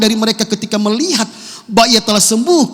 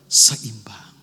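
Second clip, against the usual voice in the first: whispered word; 9 semitones lower than usual. Real, not acted.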